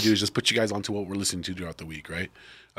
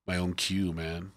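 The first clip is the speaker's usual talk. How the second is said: whispered, frustrated muttering under breath; muttered curse; 3 semitones lower, 12 dB quieter than usual. Retold or imitated. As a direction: hiss it out almost voiceless. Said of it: "it's like".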